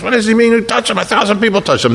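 high-pitched, baffled protest; incredulous disciples. Acted. Disciples puzzling among themselves over who touched Jesus, incredulous. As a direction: protest in disbelief, voice jumping up high. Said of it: "Probably said to the disciples".

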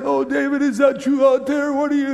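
mock hypocrite Saul. Preacher mocks Saul pretending to love David, fawning. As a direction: mimic a two-faced king sucking up. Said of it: "he's going".

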